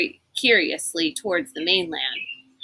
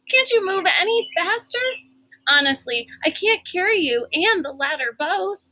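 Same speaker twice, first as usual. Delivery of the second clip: exasperated scolding; 10 semitones higher than usual. A narrator's reading. Kate scolding slow friend while running.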